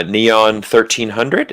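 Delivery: usual speaking voice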